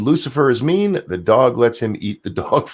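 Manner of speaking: usual speaking voice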